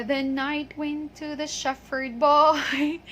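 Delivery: "shepherd" as SHEF-ferd in a slow, drawn-out lyric; wrong F pronunciation. Shown as a demonstration. Teacher mimicking a Christmas song error, F mispronunciation. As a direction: stretch the line, saying "shepherd" with F. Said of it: "so don't say".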